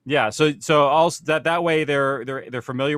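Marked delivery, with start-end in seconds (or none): none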